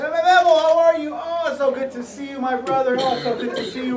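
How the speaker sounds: overjoyed reunion at the airport; excited greeting. Retold or imitated. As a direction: greet him like a long-lost brother. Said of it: "and I'm excited".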